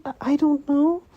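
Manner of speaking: high, unsure little voice; jumpy high-pitched "I don't know"; timid girl voice. Retold or imitated. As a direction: squeak it out like a scared girl. Nervous Momo answer, far timbre.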